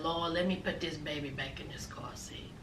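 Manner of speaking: hushed, breathy worried whisper; nervous wife whispering to herself while putting the baby back. Retold or imitated. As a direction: whisper it, scared of the fuss.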